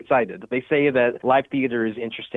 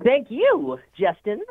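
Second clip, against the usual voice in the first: SpongeBob voice; 11 semitones higher, slower than usual. Pretend. Actor signs off in character.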